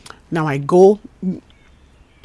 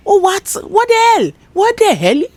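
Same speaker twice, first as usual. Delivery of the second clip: much higher and louder, shocked; outraged disbelief; 14 semitones higher, 6 dB louder than usual. Retold or imitated. Worker shocked at men flirting, high and loud. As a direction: cry out in disbelief.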